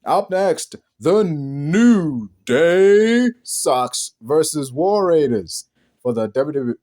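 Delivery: drawn out, very slow and loud announcer read; slow joke announcement. Shown as a demonstration. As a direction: stretch every word like a ring announcer. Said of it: "let me rephrase that".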